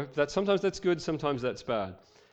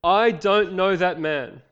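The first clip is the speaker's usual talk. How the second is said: loud, higher, very slow swearing; cursing denial; 6 semitones higher, 9 dB louder, slower than usual. Acted. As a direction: hurl it out like a curse. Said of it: "began to call down curses on himself and swore".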